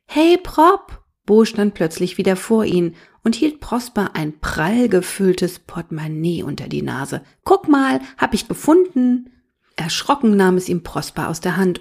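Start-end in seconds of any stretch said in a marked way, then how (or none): 0.0-1.0 put-on high, loud boyish shout; excited call. A narrator's reading. Little boy hailing his big brother, excited. 7.4-9.3 put-on high, louder, proud little-boy voice; excited boy. A narrator's reading. Little brother showing a found wallet, excited.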